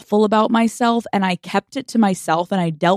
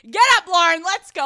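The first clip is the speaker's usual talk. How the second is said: urgent; 10 semitones higher than usual. Retold or imitated. Retold hurry on hearing the price.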